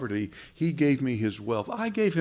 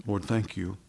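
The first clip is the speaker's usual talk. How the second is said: soft prayer; 5 semitones higher, 17 dB quieter than usual. Real, not acted. Preacher begins to pray.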